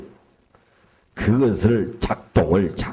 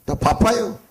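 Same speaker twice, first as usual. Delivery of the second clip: blunt Gyeongsang refusal; 6 semitones higher than usual. Retold or imitated. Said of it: "경상도 사람은 화끈하지요".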